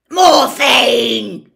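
a raw, full-throated yell from the doorway; loud shout. Acted. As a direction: bellow the name across the yard. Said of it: "said a loud voice".

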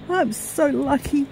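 high, slow, tearful gratitude; happy tears. Real, not acted. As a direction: voice lifted by real happy crying. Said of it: "Even if I am crying".

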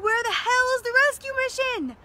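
high, loud desperate yell from a trapped pilot; retold scream. Pilot shouting at the two soldiers, high and loud. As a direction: cry out like a stranded pilot. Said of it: "the pilot screams".

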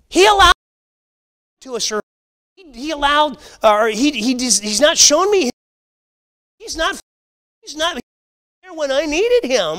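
high, whining complaint against God; whiny blaming. Retold or imitated. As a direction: mimic someone whining that God let them down, pitched high. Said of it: "We say it's His fault".

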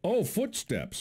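ordinary talk

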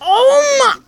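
high, loud shocked cry. Real, not acted. Gamer cries out, high, at a play. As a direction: yelp at the screen.

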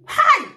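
very high loud shriek; piercing high outcry; sudden shriek. Real, not acted. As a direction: shriek out in outrage. Sudden shriek mid-rant.

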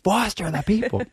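high, strained mock scream. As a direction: yell it to get through to her. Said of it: "now I'm, like, screaming".

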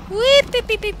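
high mock beeping sounds; beeping noise. Playfully beeping at the game screen. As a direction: chirp the beeps way up high.

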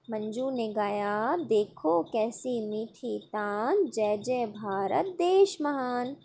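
normal talking voice

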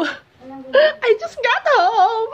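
high, very slow hammed-up British accent, laughing; a laughing, overdone British accent; mock British accent. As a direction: play at posh British with a giggle. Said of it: "we're gonna try to speak in a British accent".

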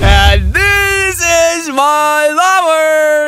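drawn-out gushing announcement; slow, loud high proclamation. Real, not acted. Streamer gushes over his favourite car, loud and slow. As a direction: announce it like a love.

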